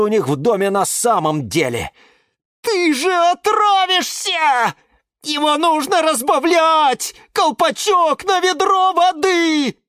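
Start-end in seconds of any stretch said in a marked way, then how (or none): 2.6-9.8 very high, slow panicked cry; frantic warning. Acted. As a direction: squeal a desperate warning. Lemon frantically warns the plant.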